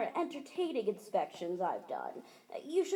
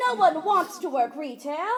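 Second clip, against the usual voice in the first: loud outburst; 3 semitones higher, 7 dB louder than usual. Acted. Inspector blurting a truth.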